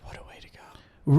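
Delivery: unvoiced, whispered aside; whispered musing. Real, not acted. Friend musing on a meteor hit, whispering. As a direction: whisper it as if to yourself.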